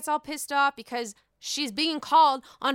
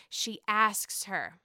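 normal talking voice

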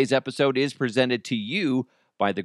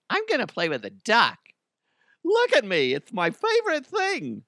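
put-on silly kid voice; 10 semitones higher than usual. Playing with rubber duck, goofy voice.

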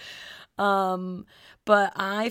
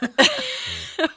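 a sudden high burst of laughter; embarrassed laugh about trust issues; 5 dB louder than usual. Real, not acted.

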